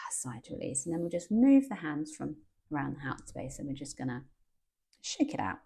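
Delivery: guided meditation. Acted. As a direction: guide them gently into calm.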